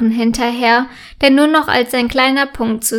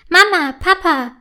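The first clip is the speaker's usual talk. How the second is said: calling her parents, impatient and raised; 6 semitones higher than usual. A narrator's reading. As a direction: hurry them along, a bit whiny. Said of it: "fragt sie ungeduldig".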